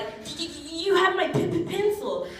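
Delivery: high stuttered mock voice; stuttering Gary. Stutter Gary demanding his pencil back, high stutter. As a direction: trip over every first sound. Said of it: "Stutter Gary".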